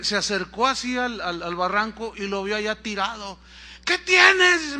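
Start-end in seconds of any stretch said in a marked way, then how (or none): 3.8-4.8 high, loud call to someone below; acted shout across a distance. Passerby to man fallen in ravine, calling down.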